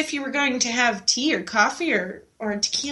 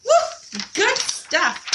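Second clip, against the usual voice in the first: cheer; 6 semitones higher, much slower than usual. Real, not acted. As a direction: whoop with excitement. Cheering the challenge.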